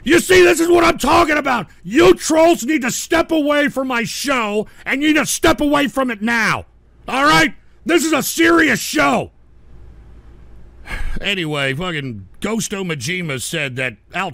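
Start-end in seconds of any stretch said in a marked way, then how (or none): 0.0-9.3 high, loud furious yelling; furious shout. Real, not acted. Host yelling at trolls to leave his show, furious shout. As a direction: tell the trolls off at full volume.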